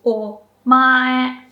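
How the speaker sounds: Omae said firmly with the rise after o; pitch accent demo. Shown as a demonstration. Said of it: "Remember to flex your abs".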